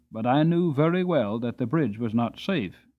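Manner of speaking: usual speaking voice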